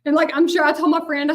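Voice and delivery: ordinary talk, nothing marked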